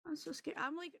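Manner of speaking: ordinary delivery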